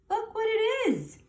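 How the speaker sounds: high, eager look-at-this; excited pointing. Real, not acted. Reader showing children the picture, excited. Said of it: "reader points at the picture".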